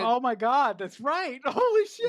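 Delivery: high, loud burst of realisation; real astonishment. Real, not acted. Co-host suddenly spotting the missed gun clue, astonished. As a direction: blurt it out, amazed and laughing.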